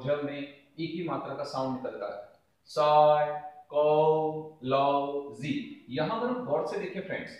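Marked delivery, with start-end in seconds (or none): 2.7-5.9 "साइकोलॉजी" drawn out very slowly, each syllable held apart. Shown as a demonstration. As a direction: stretch "साइკोलॉजी" into its separate syllables for the class. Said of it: "जब इसे बोलेंगे स में आपकी मात्रा".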